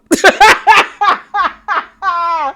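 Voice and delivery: high-pitched burst of laughter; high cackle. Real, not acted. Host cracking up at the cast's fight, laughing. Laughing at her own retelling.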